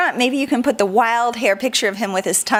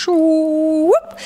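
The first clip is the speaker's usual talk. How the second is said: sliding whoop; 5 semitones higher than usual. Shown as a demonstration. Sound for the strait's path.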